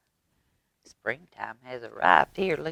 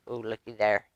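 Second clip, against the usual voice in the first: plain reading voice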